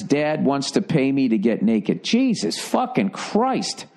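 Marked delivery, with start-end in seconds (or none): 2.0-3.9 shocked exclamation. Real, not acted. Reacts to letter subject.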